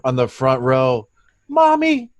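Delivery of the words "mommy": very high, put-on kiddie voice; little child. Child in the front row begging mother, squeaky little-kid voice. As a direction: plead like an eager little kid to a parent. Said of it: "Little children are on the fr".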